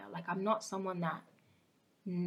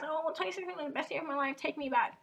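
fast, higher, gushing mock voice; mock nostalgia; 8 semitones higher, faster than usual. Mocking people who miss 2016, a fast gushing higher voice. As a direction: gush sarcastically about the good old days.

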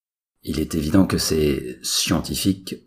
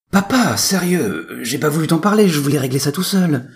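whiny son; 13 semitones higher, 7 dB louder than usual. Acted. Son caught out by his father.

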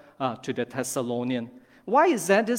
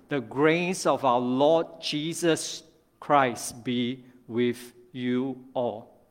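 solemn benediction; 3 semitones lower, slower than usual. Acted. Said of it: "And then he ends with this, a benediction".

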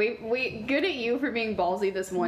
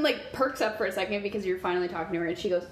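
normal talking voice

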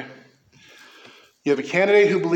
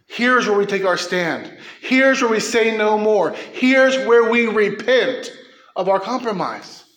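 building from firm to loud and higher; rising preaching cry; 5 semitones higher, 4 dB louder than usual. Real, not acted. Preacher to church on compromise, building to a loud cry. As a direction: climb with each here's where, slowing down.

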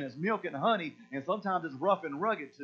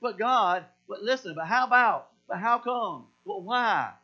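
put-on loud, higher childish whining at God; whiny believer; 4 semitones higher, 6 dB louder than usual. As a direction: beg God like a sulking child. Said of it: "And we do the same thing with God".

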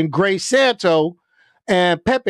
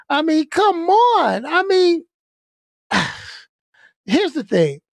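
usual speaking voice

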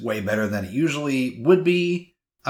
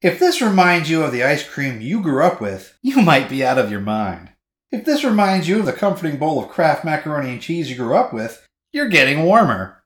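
louder, more emphatic ad-copy read; big, lilting blurb read; second-attempt blurb read; 3 semitones higher, 4 dB louder than usual. Shown as a demonstration. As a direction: push the copy hard, punching the phrases. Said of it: "Let me try it again".

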